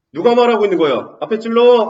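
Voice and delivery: usual speaking voice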